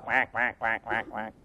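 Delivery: low, very quiet quacking; hushed imitation of passing ducks; soft duck quacks. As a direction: quack softly like distant ducks. Imitates ducks flying south.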